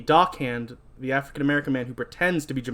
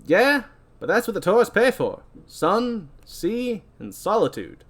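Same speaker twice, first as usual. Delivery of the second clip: higher, swinging put-on captain voice; ferry captain character; 6 semitones higher, slower than usual. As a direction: play a salty captain with emphatic lilts.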